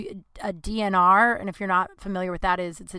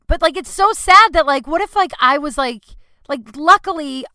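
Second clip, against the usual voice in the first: upset outburst; 7 semitones higher, 10 dB louder than usual. Real, not acted. Upset rant about vet costs.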